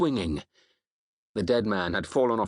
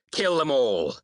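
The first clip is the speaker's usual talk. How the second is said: raised, falling war cry; battle shout; 4 semitones higher, 3 dB louder than usual. A narrator's reading. Warrior commanding the men to attack, shouted. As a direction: call out the order to the whole crew. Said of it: "I shouted".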